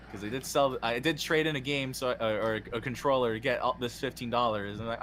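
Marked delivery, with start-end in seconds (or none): none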